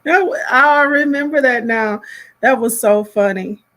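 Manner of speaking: tickled by a funny memory, laughing out loud; amused laughing. Real, not acted. Reacts amused to a remembered moment.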